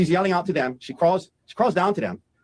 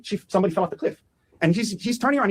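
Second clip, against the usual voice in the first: ordinary talk, nothing marked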